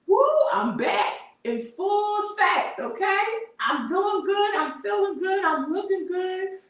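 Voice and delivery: high boastful cheer; hyped-up diva. Retold or imitated. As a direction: play the confident comeback queen. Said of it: "you just really giving like".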